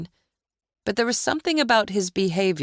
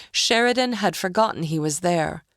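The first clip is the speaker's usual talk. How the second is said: usual speaking voice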